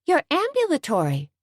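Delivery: high, amazed exclamation; startled to see him walking; surprised. A narrator's reading. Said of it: "obviously surprised".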